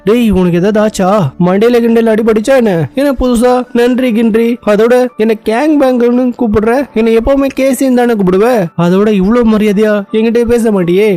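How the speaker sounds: baffled questioning. Acted. Heroine questions hero's sudden polite thanks, baffled.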